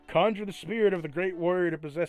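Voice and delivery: epic announcer. Acted. GM reads grand option loudly.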